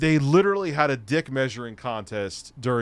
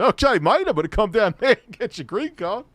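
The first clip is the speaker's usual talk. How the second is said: mock British accent; 5 semitones higher than usual. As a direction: mimic a hammed-up British accent. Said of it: "someone with a British accent".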